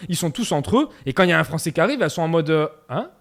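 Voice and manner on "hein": mock puzzlement. Paraguayan women wondering about a newly arrived Frenchman, quietly puzzled. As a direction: imitate someone quietly sizing up an odd newcomer.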